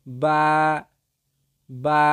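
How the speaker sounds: বা from the wet part of both lips, vowel held; slow letter-drill বা, lips meeting first; demonstrated letter sound. Shown as a demonstration. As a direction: model a long, level বা. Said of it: "দুই ঠোঁটের ভিজা জায়গা হইতে".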